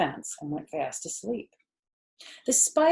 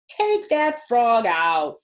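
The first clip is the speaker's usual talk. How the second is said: put-on louder, slow girl's yell; 4 semitones higher, 8 dB louder, slower than usual. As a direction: yell it slowly. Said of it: "yelled Fern".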